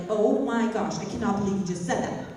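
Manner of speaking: ordinary delivery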